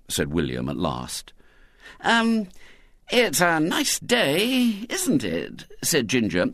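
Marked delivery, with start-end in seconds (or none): 2.0-5.7 higher, drawn-out casual small talk; easy conversational note. A narrator's reading. Ginger pretending the girl isn't there, airily conversational. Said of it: "on an easy conversational note".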